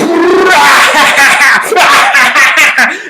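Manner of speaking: loud high laughing shout; loud laughing freak-out. Retold or imitated. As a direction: burst out in a high, laughing yell. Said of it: "me freaking out at the end".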